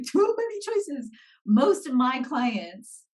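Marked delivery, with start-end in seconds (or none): 0.0-1.1 high-pitched mock toddler overwhelm; high childlike protest; whiny three-year-old. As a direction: play an overwhelmed little kid, pitched way up. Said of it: "inner three-year-old that's like".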